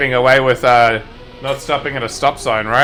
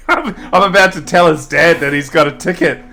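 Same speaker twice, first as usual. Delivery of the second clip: ordinary talk, nothing marked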